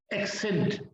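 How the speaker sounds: "एक्सेंट" said as one word, ek-sent; joined k-s sound. Shown as a demonstration. Teacher puts the word together, joined k-s sound. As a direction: say "एक्सेंट" with k then s, no gap. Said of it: "सी के बाद ई आ गया".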